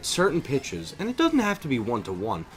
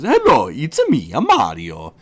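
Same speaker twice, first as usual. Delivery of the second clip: loud, lightly put-on Italian plumber voice; mock the cliché Mario greeting; a hint of a cartoon Italian accent, louder; generic Mario voice; 8 dB louder than usual. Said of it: "people just see Mario's speech patterns as".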